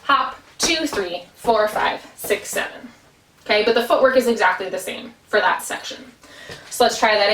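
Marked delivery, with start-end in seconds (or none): 0.0-2.9 Hop, two, three, four, five, six, seven with the even beats stressed; syncopated count. Shown as a demonstration. Dance teacher demonstrating the jig's emphasized downbeat. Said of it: "downbeat or the even beat is getting a little bit more emphasis".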